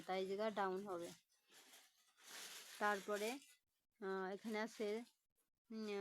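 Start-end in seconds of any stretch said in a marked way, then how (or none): none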